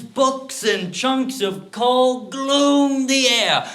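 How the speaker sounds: loud, impassioned storytelling swelling upward; frenzied narration. A narrator's reading. Man recounts hurling missiles in blind rage, frenzied. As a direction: drive the rampage forward, stretching the last phrase.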